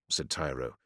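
plain reading voice

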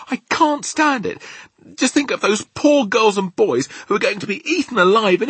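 very high, loud outcry; anguished cry. A narrator's reading. Sophie stands up over the children's fate, crying out. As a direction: cry out high, in distress for the victims. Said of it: "cried out".

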